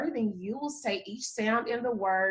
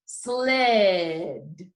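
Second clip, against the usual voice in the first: long drawn-out sled, lower pitch; say it slowly; 5 semitones lower than usual. Shown as a demonstration. Teacher saying it one more time for children, slowly. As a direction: stretch sled so every sound shows. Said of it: "say it one more time for you".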